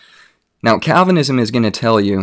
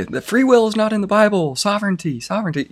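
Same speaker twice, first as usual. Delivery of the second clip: mocking imitation; 11 semitones higher than usual. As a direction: parody the people who keep saying it, through a laugh. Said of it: "you'll hear people so often say".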